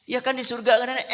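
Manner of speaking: put-on high, fast, fretful old woman's voice; squeaky worried grandma; anxious grandmother. Grandmother complains.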